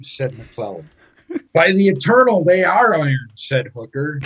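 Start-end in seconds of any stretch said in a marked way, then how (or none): 1.5-3.3 loud, proud declaration; emphatic exclamation. Retold or imitated. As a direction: proclaim it loudly. Quoting Hooker's exclamation.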